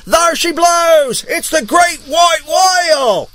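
high, long drawn-out holler; lookout's call. Acted. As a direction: holler it down from the crow's nest. Lookout calls out the whale.

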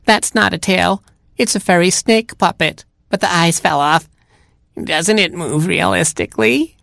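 put-on high, chatty old granny; cat posing as grandma. Cat makes excuses to Robin as grandma, high mock-granny voice.

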